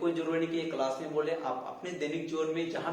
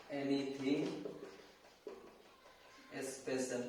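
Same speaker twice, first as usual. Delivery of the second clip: slow, word by word; 8 dB quieter, much slower than usual. Shown as a demonstration. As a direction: split into words with long pauses. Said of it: "teacher repeats phrase slowly". (शब्द-शब्द रुककर धीरे)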